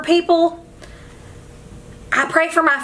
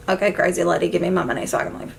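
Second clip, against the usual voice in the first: low, hurried dismissive aside; unimpressed delivery guy; 5 semitones lower, 4 dB quieter, much faster than usual. Retold or imitated. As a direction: lightly voice a guy who wants out. Acts out unimpressed delivery dude.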